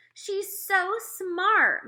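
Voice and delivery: high, very slow admiring gush; drawn-out doting mother; mother's gushing praise. Acted. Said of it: "my mother said".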